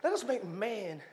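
God voiced as a relaxed friend; casual God voice. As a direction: pitch the idea like a buddy. God voiced casually planning man.

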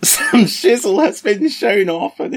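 high, slow gushing delight; excited. Real, not acted. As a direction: gush about the reveal. Excited about reveals.

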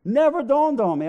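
a loud, high comic outburst; exclaiming. Real, not acted. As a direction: shout it out as a joke on yourself.

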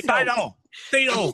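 playful imitation of an angry Japanese man; lightly hammed-up Japanese accent, three syllables; angry Japanese guy. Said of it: "like an angry Japanese guy".

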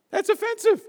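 squeaky high mock outrage. Real, not acted. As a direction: pitch it way up in fake shock.

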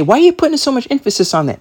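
high, fast, baffled objection; incredulous question. Retold or imitated. Listener asking the preacher why he stresses sex, high and incredulous. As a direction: fire it back high and disbelieving. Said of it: "You say".

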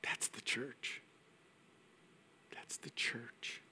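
whispered slowly, almost breathless; hushed whisper. Real, not acted. Pastor moved by the prayer story, whispering slowly. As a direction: let it sink in softly. Tender whispered reflection on her.

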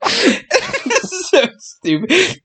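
very high, drawn-out laughing squeal; helpless laughter. Real, not acted. Podcaster embarrassed by own story, helplessly laughing. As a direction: stretch the words out through a laughing fit.

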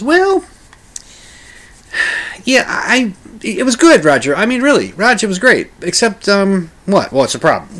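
hesitant, high-pitched cautious producer; high Bob voice. Retold or imitated. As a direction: squeak out the doubt nervously. Bob voiced in retold story.

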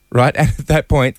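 normal talking voice

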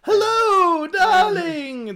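high, loud sing-song greeting; flamboyant shopkeeper voice. Acted. Shop owner greeting a return customer, high and sing-song. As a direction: trill it like a flamboyant tailor. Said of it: "That's the voice I had for him".

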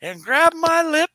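high, loud storytelling comic voice; Cosby bit. Retold or imitated. Recalling an old Cosby childbirth bit with the host. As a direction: deliver the punchline big. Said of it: "He goes".

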